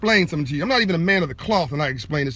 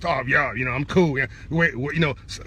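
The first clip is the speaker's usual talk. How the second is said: mocking cool talker; 4 semitones lower than usual. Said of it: "talking all this madness".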